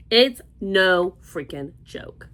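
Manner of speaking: "No freaking joke" spelled out slowly, each word hit; slow emphatic. Real, not acted. Insisting to viewers how hot Florida is, slowly and emphatically. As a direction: drag out every word for emphasis.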